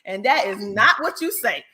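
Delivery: ordinary talk, nothing marked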